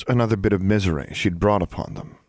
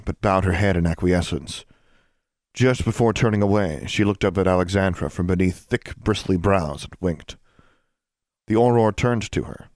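plain reading voice

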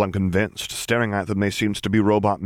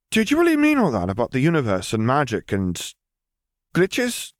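put-on higher, matter-of-fact girl's voice; girl brushing off talk of a dead lord, higher; young girl Lily; 7 semitones higher than usual. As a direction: say it lightly, as if it's nothing. Lily prompting.

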